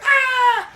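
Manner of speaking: high, loud mock scream; little girl screams in fright; frightened shriek. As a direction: yelp like a terrified kid. [name] scared screaming.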